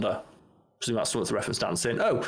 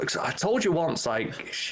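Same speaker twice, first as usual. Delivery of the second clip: ordinary delivery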